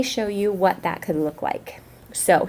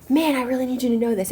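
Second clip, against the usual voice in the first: emphatic, raised pitch; higher, insistent exclamation; strong feeling; 5 semitones higher than usual. Shown as a demonstration. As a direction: urge it on the listener with feeling. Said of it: "we're trying to show strong feeling".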